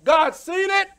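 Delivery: preaching at full force. Acted. Preacher warning that God sees everything, loud. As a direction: thunder the verse at them.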